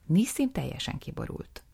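normal talking voice

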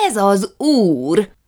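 loud, slow proclaiming shout; slow, thundering announcement; booming declaration. A narrator's reading. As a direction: trumpet it out to the room. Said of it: "harsogta Jukrics".

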